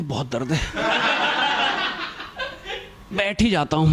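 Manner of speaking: mock agony of a faker milking a scraped knee; overacted pain. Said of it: "उसकी over acting चालू".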